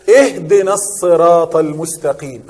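slow chanted recitation, اهدنا stressed and falling; Quran recitation. Shown as a demonstration. Teacher reciting the verse to students, slow chanted recitation.

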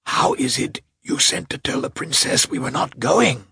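high, strained hoarse whisper, voice giving out; angry hoarse whisper. Acted. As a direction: hiss it angrily in a failing whisper. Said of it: "whispered hoarsely and still more angrily".

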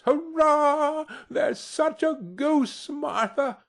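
put-on very high, drawn-out child's hurrah; high-pitched gleeful squeal of news; children cheering. A narrator's reading. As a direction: cheer like excited little children. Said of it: "cried the two young Cratchits".

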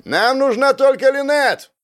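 very high, drawn-out shout; high insistent yell; yelling demand. A narrator's reading. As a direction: shout it slowly from afar. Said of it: "орут справа".

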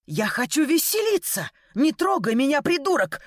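high-pitched, heated outburst; angry protest. A narrator's reading. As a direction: fight back angrily, voice climbing. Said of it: "шипела я от злости".

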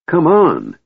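high, loud eager call; a kender yelling to Tika to run; excited yell. A narrator's reading. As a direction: yell to hurry her along. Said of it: "Tass yelled in excitement".

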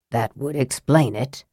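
low, soft murmur. A narrator's reading. Raistlin mutters to Sturm about the helm, low and soft. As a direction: say it half to himself. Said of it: "Raistlin murmured".

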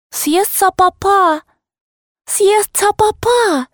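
put-on high, slow childish babble; lisping little girl. A narrator's reading. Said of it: "залепетала девочка картавя". (детский лепет, картаво и высоко)

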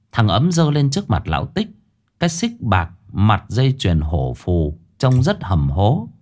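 plain reading voice

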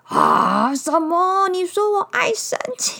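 loud, high, shocked bear; outraged bear. Acted. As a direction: gasp it out indignantly.

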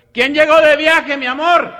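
very high, loud, slow sweet question; sugary husband falsetto. Acted. Comedian voicing a congressman asking his wife who arrived, in a loud falsetto. Deputy voiced in joke.

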